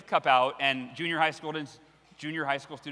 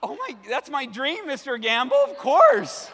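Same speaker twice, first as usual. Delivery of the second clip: much higher, voice breaking up on the last words; excited voice crack; 8 semitones higher, 7 dB louder than usual. Retold or imitated. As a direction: gush it out until the voice cracks. Teen accepts dream radio job.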